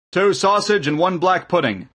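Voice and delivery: high, loud call to the kitchen; shouted order. A narrator's reading. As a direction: shout the order through the door. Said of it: "opened the door into the kitchen and shouted".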